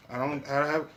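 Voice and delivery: normal talking voice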